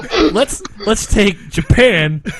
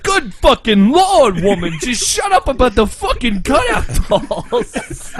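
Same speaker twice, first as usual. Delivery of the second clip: raised, exasperated mock dub voice; hammed-up fed-up outburst; Joey; 5 semitones higher than usual. Friend voicing a character fed up with friendship talk, exasperated. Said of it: "Even Joey's like".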